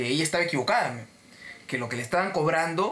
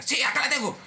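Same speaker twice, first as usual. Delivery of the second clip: high-pitched, insistent customer voice; high mock-belligerent retort; belligerent caller; 10 semitones higher than usual. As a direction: mimic a combative woman answering back, pitched way up. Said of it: "seguía con esa actitud beligerante".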